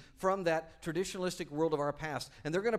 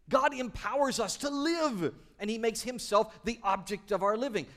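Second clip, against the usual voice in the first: higher, louder, building to a peak; fired-up preacher marvelling; excited preaching; 8 semitones higher, 11 dB louder than usual. Acted. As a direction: let the wonder burst out.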